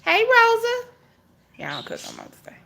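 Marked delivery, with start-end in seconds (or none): 0.0-0.9 much higher, louder hello; sing-song greeting. Real, not acted. Greeting Rosa in the chat, high loud sing-song hello. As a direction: pop the name up high. Greeting viewer on live stream.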